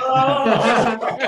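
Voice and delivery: high laughing oh. Real, not acted. Laughs at the story.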